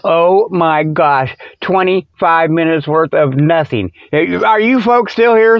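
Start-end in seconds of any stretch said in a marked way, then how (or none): none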